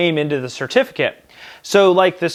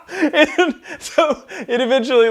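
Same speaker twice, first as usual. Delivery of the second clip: laughing through the words; can't hold back the laugh; 11 semitones higher than usual. Real, not acted. Storyteller cracking up at his own story, laughing.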